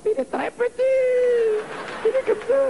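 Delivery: very high squeaky voice; a squeaky, very high mock voice for the tiny president.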